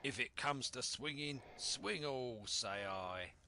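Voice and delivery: heated captain daring them all; defiant cry. Acted. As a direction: throw down a threat. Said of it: "he cried".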